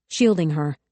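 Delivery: usual speaking voice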